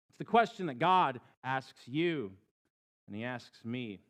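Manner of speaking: usual speaking voice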